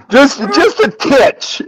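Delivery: high, slow, squeezed through laughter; laughing admission. Retold or imitated. Friend admitting he is paranoid, imitated through laughter.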